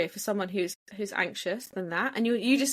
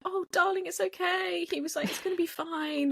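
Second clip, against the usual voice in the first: soothing porter; 10 semitones higher than usual. Retold or imitated.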